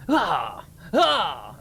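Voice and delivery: short loud "Ah!" scream; demonstrated scream. Shown as a demonstration. Streamer showing viewers how to scream, loud scream. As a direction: let out a quick, loud demo scream. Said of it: "you must scream".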